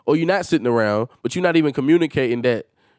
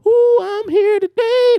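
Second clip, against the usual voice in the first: high, slow, prissy mock voice; mocking impression; 5 dB louder, slower than usual. Mocking how his brother shows up to record, high prissy. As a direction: ham up a fake cheerful arrival. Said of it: "I'm not talking to you as if".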